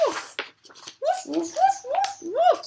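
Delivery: high puppy woofs, starting airy; dog barking. Acted. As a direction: bark like an eager little dog. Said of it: "said Toasty".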